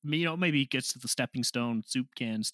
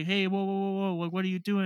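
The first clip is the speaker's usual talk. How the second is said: alarmed; 6 semitones higher than usual. Retold or imitated. Retold referee stopping fight.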